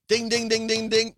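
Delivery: high sung ding ding; game show bell. Pretend. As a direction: chime like a quiz show winner bell. Declaring the right answer.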